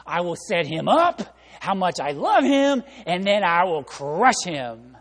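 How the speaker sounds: mock scheming wife gloating slowly; wife plotting against her husband, gleeful scheming; vengeful glee. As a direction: savor the revenge plan, hammed up. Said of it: "with revenge in her eyes".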